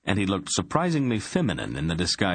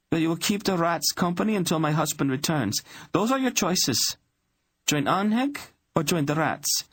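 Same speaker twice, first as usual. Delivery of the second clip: much higher, cold royal command; commanding queen voice; 11 semitones higher than usual. Acted. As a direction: hand down a decree as queen. Queen orders Grodig away.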